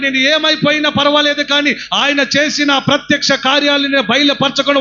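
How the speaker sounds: loud, high preaching cry; fervent sermon shout; full-force preaching. Acted. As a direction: exhort the crowd with all your voice.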